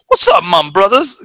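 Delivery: high, eager "What's up, my brothers"; nerdy try-hard greeting. Retold or imitated. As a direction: overdo the friendly greeting. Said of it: "he's like".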